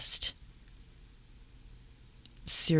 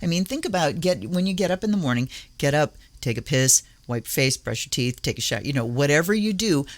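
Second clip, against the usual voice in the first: ordinary delivery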